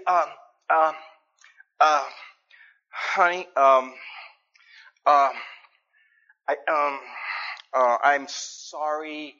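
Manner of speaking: halting, mumbled sorry to his wife; sheepish apology. Retold or imitated. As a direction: stammer it out like a nervous husband.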